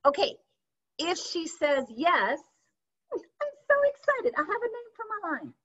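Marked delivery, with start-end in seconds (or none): 3.1-5.5 much higher, gushing fast thrill; squealing excited. Shown as a demonstration. Coach acting out a customer saying yes. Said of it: "You're selling the excitement".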